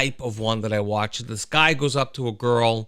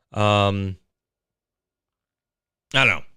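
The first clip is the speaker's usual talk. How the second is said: usual speaking voice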